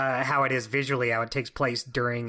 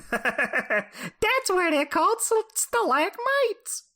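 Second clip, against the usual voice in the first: put-on very high, drawn-out little kid voice; hammed-up baby voice, pitched way up; little baby Heil; much slower than usual. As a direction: squeak it slowly like a baby with a Jersey accent. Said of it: "That's my impression of little baby Heil".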